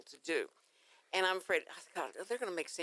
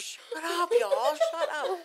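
playful shut up; 10 semitones higher, 5 dB louder than usual. Real, not acted. Laughing at the teasing.

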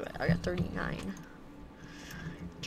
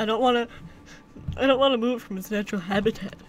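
ordinary delivery

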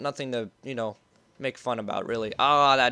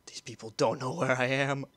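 normal talking voice

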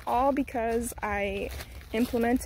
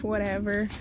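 ordinary delivery

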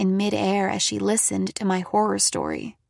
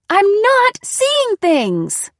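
high, drawn-out, furious shriek; 11 semitones higher, 8 dB louder, much slower than usual. A narrator's reading. Daughter denying hallucinations to mom, shrieking. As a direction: shriek the denial slowly and loudly. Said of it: "I shrieked".

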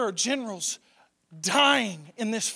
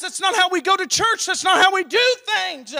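normal talking voice

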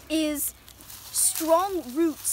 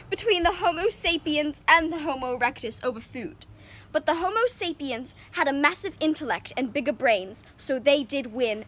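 ordinary talk, nothing marked

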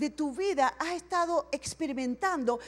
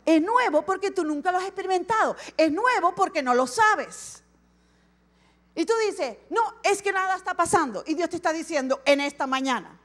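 louder and higher, driving the point; fervent pastor raising the voice; preaching at full force; 4 semitones higher, 6 dB louder than usual. Real, not acted. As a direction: proclaim it to the whole congregation. Preaching builds to climax.

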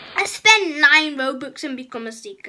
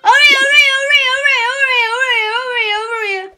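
taunting call; 7 semitones higher, 5 dB louder, much faster than usual. Real, not acted. Taunting the seeker.

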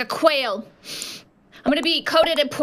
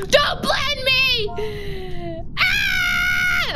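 high, slow hammed-up wailing plea; begging not to be blended, hammed-up; mock panicked cry; 9 semitones higher, much slower than usual. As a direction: cry out in fake terror, drawn out. Character pleading in game.

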